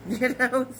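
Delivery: laughing words, then a breathy chuckle. Real, not acted. Host shrugging off life's troubles to viewers, laughing it off.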